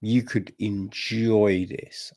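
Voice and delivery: usual speaking voice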